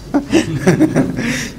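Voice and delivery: high chuckle breaking out; short run of laughter; amused chuckle. Real, not acted. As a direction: chuckle at your own joke. Amused at his retold question.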